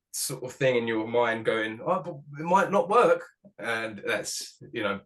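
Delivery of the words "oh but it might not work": higher, a touch louder worried inner voice; the anxious thought that creeps in; inner doubt. Retold or imitated. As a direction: voice the nagging little doubt in your head. Doubting voice in his head.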